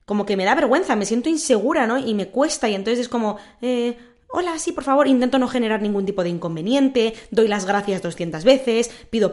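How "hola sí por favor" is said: put-on higher, timid little girl politeness; a lighter echo of the good-girl voice. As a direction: sound like a polite twelve-year-old. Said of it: "esa vibra de niña buena".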